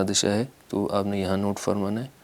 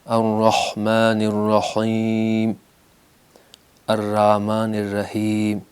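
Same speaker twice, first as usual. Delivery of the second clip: الرحمن الرحیم recited slowly with a clear ح; careful tajweed chant; slow recitation; slower than usual. Shown as a demonstration. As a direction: model the verse slowly for the class. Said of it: "الف اور ہائے دو چشم".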